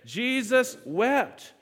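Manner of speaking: Jesus wept, said slowly with weight; slow emphatic recitation. Shown as a demonstration. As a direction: stretch both words for the room to echo. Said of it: "Let's say that together again".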